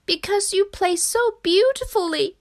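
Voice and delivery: high, tearful frog voice; acted weepy storybook voice; weeping Frog. A narrator's reading. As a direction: wail it high, as a frog moved to tears. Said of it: "wept Frog".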